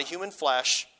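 ordinary delivery

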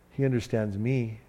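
softly, a hint of hurt dog owner; mildly wounded, hushed; quiet sad reply. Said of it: "And he's real sad".

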